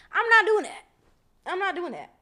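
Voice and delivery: high, loud protest; loud, indignant refusal; emphatic refusal. Real, not acted. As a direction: shut it down at a high pitch. Emphatic refusal during her story.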